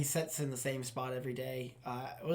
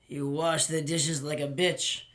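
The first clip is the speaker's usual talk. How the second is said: slow, louder, drawn-out impression; Gus's accent; 6 dB louder, slower than usual. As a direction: stretch it out slowly, imitating the boss's accent. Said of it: "when he say stuff in that accent".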